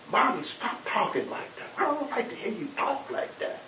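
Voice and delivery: high-pitched retold cry of distress; imitated shriek. Father pleading with Martin to stop, imitated high shriek. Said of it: "I heard him shriek".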